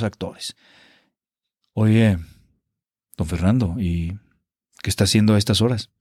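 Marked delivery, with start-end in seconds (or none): 1.7-5.9 solemn period-drama actor. Pretend. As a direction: parody stiff theatrical period acting. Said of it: "todo tiene que ser muy solemne".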